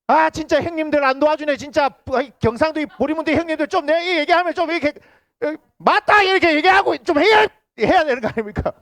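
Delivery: high, loud mock complaint; playful pleading. Real, not acted. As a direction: tease the silent elders into agreeing. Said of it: "teasing the unresponsive elders".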